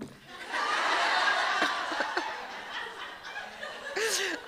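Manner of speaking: breathless high burst of laughter; a long, high, airy laugh; embarrassed high laugh. Real, not acted. Laughs at her own confession.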